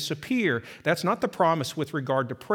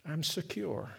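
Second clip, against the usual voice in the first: usual speaking voice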